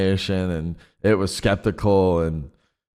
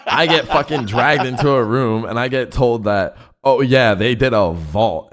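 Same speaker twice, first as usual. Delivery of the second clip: raised voice, higher pitch, defensive; exasperated protest; 5 semitones higher, 5 dB louder than usual. Real, not acted. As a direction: protest your innocence loudly. Defends himself against friends' accusations.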